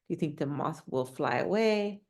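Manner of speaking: ordinary delivery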